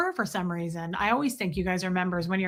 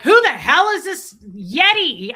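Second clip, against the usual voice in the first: exasperated; 5 semitones higher, 9 dB louder than usual. Retold or imitated. Loudly complaining about the smoker.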